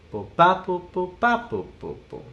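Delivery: "ba" punched, "bo" left unaccented; vocalized drum rhythm, hitting each "ba" hard; accent on the E. Shown as a demonstration. Drum teacher showing where the accent moves, stress on "ba". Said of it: "instead of the accent on the downbeat".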